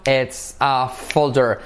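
slow linked phrase; slow model, linked; slow together. Shown as a demonstration. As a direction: run it together, slowly. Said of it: "Todo junto".

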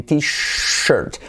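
"t-shirt" with a long hushed sh. Shown as a demonstration. Teacher contrasting it with teacher, drawn-out sh. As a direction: linger on the sh in "t-shirt". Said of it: "con el sonido que hemos trabajado".

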